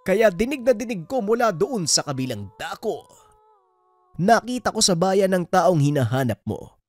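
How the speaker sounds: put-on high, swooping old man's voice; singsong character voice of an old villager; old stranger. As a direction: speak as a meddling elder with exaggerated rises and falls. Voices Tandang Justino, a stranger.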